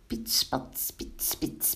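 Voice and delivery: whispered spitting sizzle; sizzling butter sounds. A narrator's reading. Narrator imitates butter and batter frying, whispered sizzle. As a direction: imitate batter sputtering in the pan. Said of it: "deden de boter en het meel samen in de pan".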